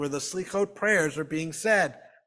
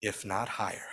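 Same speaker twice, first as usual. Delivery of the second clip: quietly; 8 semitones lower, 9 dB quieter than usual. A narrator's reading. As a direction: lower and much softer, a near-hushed punchline.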